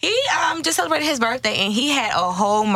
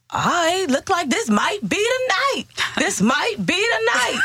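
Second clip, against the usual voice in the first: man voice; 9 semitones higher than usual. Retold or imitated. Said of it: "This is how he was talking".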